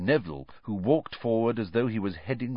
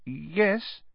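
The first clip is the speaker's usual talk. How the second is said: higher-pitched, tentative yes; wondering, hesitant pupil; uncertain; 9 semitones higher than usual. A narrator's reading. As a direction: agree without being sure. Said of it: "said Neville uncertainly".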